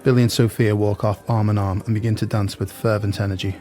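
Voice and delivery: ordinary talk, nothing marked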